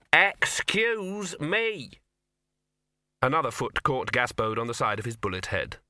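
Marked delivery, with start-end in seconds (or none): 0.1-2.0 very slow, higher drawn-out plea; insistent excuse me. Acted. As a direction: stretch each word, exasperated.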